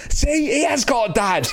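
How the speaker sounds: high, slow mock shout of a daughter; shouting child. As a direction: imitate a kid announcing it to everyone, high-pitched. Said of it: "let's stop shouting about dads".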